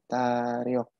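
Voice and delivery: "tariok" drawn out with a clear r; demonstrated reading. Shown as a demonstration. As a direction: sound out "tariok" slowly, syllable by syllable. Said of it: "Kalau dia tulisannya seperti ini".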